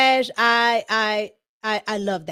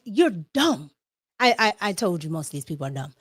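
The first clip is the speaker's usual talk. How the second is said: ordinary delivery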